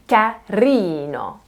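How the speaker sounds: CARINO drawn out slowly on RI; overemphasized stress. Shown as a demonstration. Teacher urging learners to enjoy it, overemphasized RI in Carino. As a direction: play with it, overdo the long RI in Carino. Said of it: "overemphasize this".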